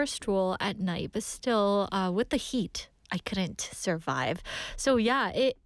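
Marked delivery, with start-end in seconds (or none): none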